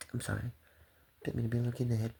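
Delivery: usual speaking voice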